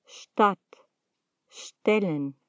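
Stadt, Stellen begun straight on "sht"; no E before ST. Shown as a demonstration. Teacher showing learners ST without an E, "sht" onset in Stadt, Stellen. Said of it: "Delante de la S T nunca se pronuncia una E".

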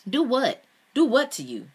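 angrily. A narrator's reading. Man confronted by his lover, angrily demanding. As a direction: snap it back, stung. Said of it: "he said angrily".

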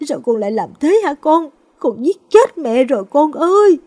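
high, loud sobbing lament; mother laments her son's death, building to a loud wail; anguished wail. Acted. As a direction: break down over the son. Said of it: "gào lên".

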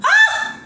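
very high, short "ah" of horror; high-pitched gasping exclamation; shocked cry. Real, not acted. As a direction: cry out, appalled. Exclaims in horror at children's size.

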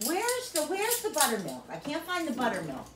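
mock-whiny lady asking for buttermilk; whiny shopper. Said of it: "she's like".

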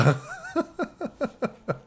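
high-pitched real giggling fit; helpless laughter. Real, not acted. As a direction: crack up at the scene. Rit de la scène. (fou rire aigu)

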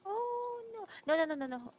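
high-pitched oh, then rapid no's; dismayed surprise. Real, not acted. Teacher realising she got the time wrong, dismayed.

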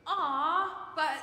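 high, loud put-on whine; whiny voice. Shown as a demonstration. Pastor shows children how to whine, playful whiny voice. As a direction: whine like a protesting kid, hammed up. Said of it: "a little whiny voice goes".

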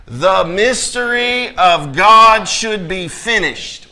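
very slow, loud, deliberate recitation; leading congregational reading. Real, not acted. Preacher prompts the congregation to read along, slow, loud and emphatic. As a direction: draw it out, urging everyone to join. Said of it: "come on, can I hear you?".

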